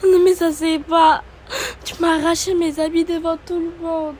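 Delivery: high, tearful complaint; acted crying. Humiliated housemate cries to her rival about her clothes being ripped. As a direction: sob it out, humiliated.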